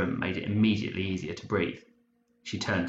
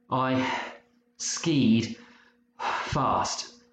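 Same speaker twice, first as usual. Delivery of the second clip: panting; 5 semitones higher, much slower than usual. A narrator's reading. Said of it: "he panted".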